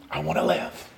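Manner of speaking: very low, hushed plea; almost under the breath, spent; David. Acted. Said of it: "This is David going".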